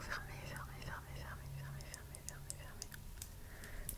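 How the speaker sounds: repeated whisper trailing to almost nothing; fading whisper. Acted. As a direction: let it dissolve into silence. Répétitions de plus en plus basses. (chuchotement qui s'évanouit)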